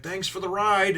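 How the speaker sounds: high, put-on buddy voice; chummy thanks. Zoro thanking Hachi for the lift, mock chummy voice. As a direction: play an over-friendly parting. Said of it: "they're like buddies".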